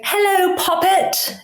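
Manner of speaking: high mock-British "Hello, Poppet."; a clumsy put-on British "Hello, Poppet"; bad British accent. Guest mocks her own British attempt, high and silly. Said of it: "it sounds like I just say".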